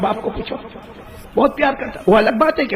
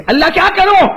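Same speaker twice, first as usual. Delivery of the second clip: anguished cry; 13 semitones higher, 6 dB louder than usual. Acted. Ibrahim crying out to God.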